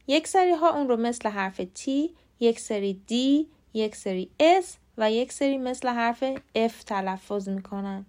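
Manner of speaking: ordinary delivery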